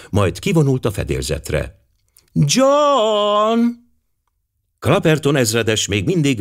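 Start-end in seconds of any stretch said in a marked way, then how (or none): 2.3-3.6 high, drawn-out call to her husband. A narrator's reading. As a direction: call John in a long high cry. Wife calls her husband. (magas, elnyújtott kiáltás)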